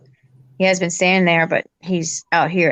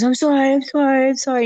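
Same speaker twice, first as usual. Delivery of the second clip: high, lightly mocked pleading; beg, pitched up; pleading homeless man; 6 semitones higher than usual. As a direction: homeless man apologizing to the son. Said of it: "he goes".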